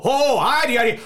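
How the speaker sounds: high, loud put-on Irish greeting; fake Irish accent. Customer greeting Sean at the cart, fake Irish accent. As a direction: gush it in a mock Irish accent. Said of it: "does he need the fake Irish accent?".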